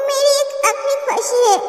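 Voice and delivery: quiet, tender and grieving; soft sorrowful wish. Acted. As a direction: say it gently, like a last wish. Recalled dying wish to husband.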